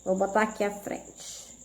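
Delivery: usual speaking voice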